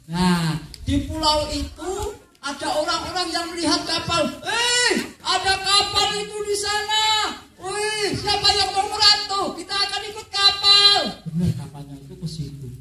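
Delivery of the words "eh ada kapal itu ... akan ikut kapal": higher, eager calls of villagers spotting a ship; excited villagers. Acted. Villagers spotting a ship, raised excited voices. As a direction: point out the ship with delight.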